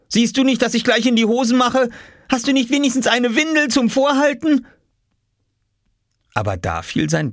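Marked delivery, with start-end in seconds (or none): 0.1-4.7 high, frantic, cross questions; scolding. A narrator's reading. Oskar about to wet himself, scolding Lars. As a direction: rant in high-pitched desperation. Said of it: "schimpfte Oskar".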